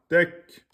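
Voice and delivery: "Tek" with k stopped, then a puff of breath; blocked, released k. Shown as a demonstration. Teacher showing kaf without qalqala, breath released. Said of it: "On bloque, on lâche le, le souffle".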